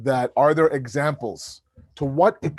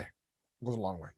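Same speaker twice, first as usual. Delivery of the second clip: softer, lower and reflective; quiet emphatic repeat; 7 semitones lower, 14 dB quieter than usual. Real, not acted. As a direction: let it sink in quietly.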